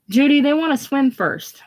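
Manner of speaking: put-on high young girl calling up the hill; girl's voice. A girl from the tour answering Judy, high call. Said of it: "Girl's voice".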